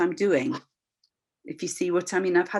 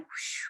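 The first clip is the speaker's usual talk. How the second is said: airy, hushed whooshing noise; soft, voiceless sweep of sound; 15 dB quieter than usual. Shown as a demonstration. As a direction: imitate a light rush of air. Imitated noise of fine energy.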